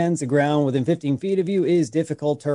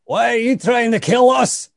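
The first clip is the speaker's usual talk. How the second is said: loud in-character reply; 11 semitones higher, 7 dB louder than usual. Acted. Player answers spirit in character.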